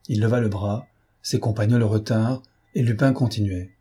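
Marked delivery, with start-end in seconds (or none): none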